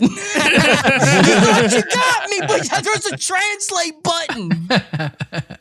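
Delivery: high, drawn-out laughing taunt; gleeful gloating. Real, not acted. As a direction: crow triumphantly through laughter. Gleefully beating a listener's Swedish prank.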